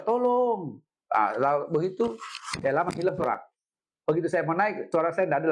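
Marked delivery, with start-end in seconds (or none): 0.0-0.8 mock cry for help, pitched higher; retold cry for help. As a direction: call out for help, half-acted. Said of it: "Saya teriak". (teriakan minta tolong yang ditirukan, nada lebih tinggi)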